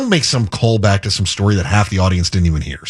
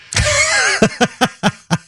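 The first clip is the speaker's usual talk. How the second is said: high burst of laughter. Real, not acted. Host amused by jab at colleague, laughing. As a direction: crack up at your own jab.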